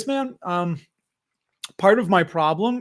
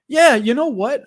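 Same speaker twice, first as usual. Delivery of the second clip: "yeah, you know what" blurted louder and higher; sudden yell; 7 semitones higher, 6 dB louder than usual. Real, not acted. Excited streamer yelling into the mic at viewers. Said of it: "sorry if I yelled into the mic".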